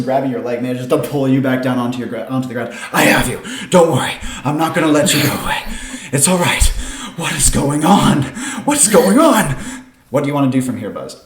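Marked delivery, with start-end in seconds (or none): 2.9-9.6 mildly alarmed, rising to louder and higher; from urgent reassurance to alarmed questioning; urgent rescuer. Acted. Rescuer grabs floating man, alarmed.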